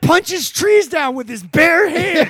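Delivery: hype announcer intro. Acted. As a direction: ring announcer in full hype. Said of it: "introduction like that".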